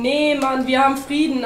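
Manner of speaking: exasperated contradiction; arguing back. A narrator's reading. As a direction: push back against the shouting. Bear argues back at penguin.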